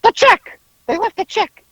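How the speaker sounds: high-pitched urgent demand; Howard. Retold or imitated. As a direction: imitate an agitated man demanding in a high voice. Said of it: "and Howard, he's like".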